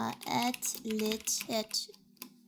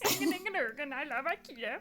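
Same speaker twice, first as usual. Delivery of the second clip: mock Swedish chef; 2 semitones higher, 3 dB quieter than usual. Streamer doing the Swedish chef for chat. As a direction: ham up the Muppets chef. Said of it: "Close to the Swedish chef from the Muppets".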